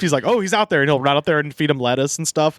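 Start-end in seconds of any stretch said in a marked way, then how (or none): 0.2-0.8 higher-pitched, excited announcement; mildly hyped retelling; hyped dad. Retold or imitated. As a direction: lightly imitate an excited dad. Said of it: "my dad gets so hyped".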